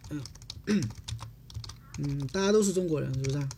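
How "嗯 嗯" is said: ordinary talk, nothing marked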